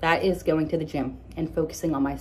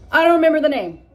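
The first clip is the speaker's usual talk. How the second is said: exasperated; 10 semitones higher, 10 dB louder, faster than usual. Real, not acted. Forgetting a dish name.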